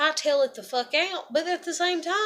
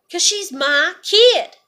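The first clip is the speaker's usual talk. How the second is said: anguished outburst; 4 semitones higher, 8 dB louder, slower than usual. Real, not acted. Grieving mother, lost child.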